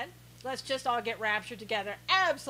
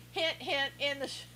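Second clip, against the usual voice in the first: ordinary talk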